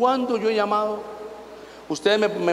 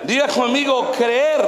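call to repeat; 7 semitones higher, 4 dB louder, slower than usual. Real, not acted. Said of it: "leads congregation repeat".

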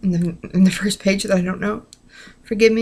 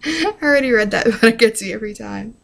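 laughing while talking; 3 semitones higher, faster than usual. Real, not acted. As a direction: talk through a laugh. Laughs at the joke.

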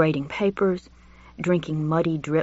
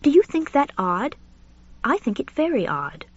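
put-on lightly raised, fussy elderly gentleman; a genteel old professor gossiping, pitched up; Julian's voice; 10 semitones higher than usual. Narrator voices Julian confiding to Richard, genteel and high.

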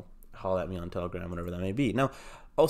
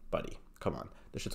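whisper; 8 dB quieter than usual. Real, not acted. Hyping up a meme coin.

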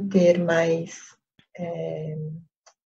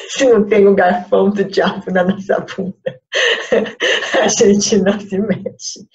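repeats it through real laughter, louder; laughing; 2 semitones higher, 6 dB louder than usual. Real, not acted. Teacher retelling her joke to students, laughing out loud. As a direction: laughs while saying it again.

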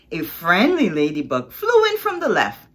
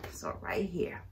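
whisper; 12 dB quieter than usual. Real, not acted.

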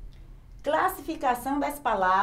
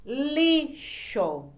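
"lixo" split higher-pitched as li, xo; two separate sounds; 5 semitones higher than usual. Shown as a demonstration. Teacher showing a word has two sounds, split syllables. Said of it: "Aqui tem dois sons".

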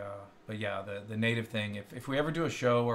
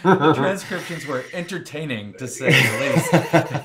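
laughing, louder, amused; 3 semitones higher, 13 dB louder, slower than usual. Real, not acted. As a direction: laugh through the words, tickled. Laughing at funny transcription errors.

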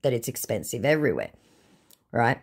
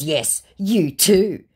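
slow, loud, higher mock retort; drawn-out put-on comeback; retorting scammer; 6 semitones higher, 5 dB louder, much slower than usual. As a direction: throw it back as the scammer.

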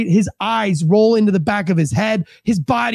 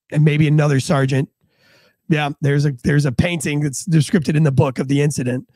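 usual speaking voice

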